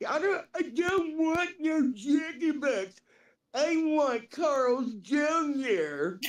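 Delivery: high, wailing picky refusal; Roger impression. Homeless man refusing the offered restaurant, high drawn-out whine. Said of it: "Roger talks like this".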